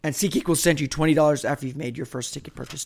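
ordinary delivery

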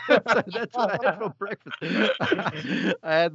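chuckling as he wraps up the punchline; laughing. Real, not acted. Telling the co-hosts his breakfast order, laughing.

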